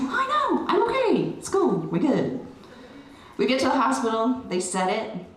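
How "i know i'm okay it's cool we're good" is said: squeaky, much higher kid voice, playing it cool; high mock-calm child voice; high brave daughter voice. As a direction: voice the daughter brushing it off, pitched way up. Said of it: "And she's like".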